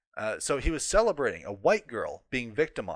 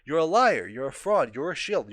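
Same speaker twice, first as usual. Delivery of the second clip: angry accusation; 4 semitones higher, 5 dB louder than usual. Real, not acted. Accusing the article's author directly.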